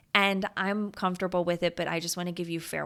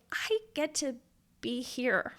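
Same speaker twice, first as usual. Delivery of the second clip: higher, tearful voice on the edge of crying; fighting back tears as she says it; near tears; 4 semitones higher than usual. Real, not acted. Host to listeners, choked up and nearly crying. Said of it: "Like, I'm gonna tear up just".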